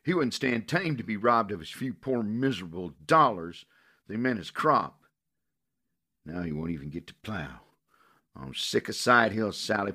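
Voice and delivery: high, loud grieving anger; bitter fury. Acted. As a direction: lash out in bitter grief. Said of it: "Her voice held a bitter fury".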